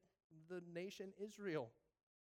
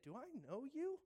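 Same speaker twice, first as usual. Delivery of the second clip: puzzled question; 7 semitones higher than usual. Retold or imitated. Phil puzzled by a stranger.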